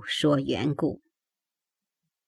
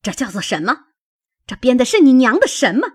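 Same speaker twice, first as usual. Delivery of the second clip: rapid questioning that bursts louder; angry demand; 5 semitones higher, 6 dB louder, much faster than usual. A narrator's reading. Mother shoving willow twigs at her daughter, angry demand. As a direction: demand an answer, suddenly fast and high.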